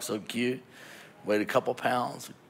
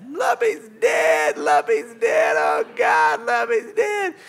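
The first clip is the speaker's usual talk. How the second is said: high-pitched frantic wail; hammed-up hysterical shriek; screaming; 12 semitones higher, 8 dB louder, slower than usual. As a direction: scream it in panic, imitating a distraught wife. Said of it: "[name]'s standing there screaming".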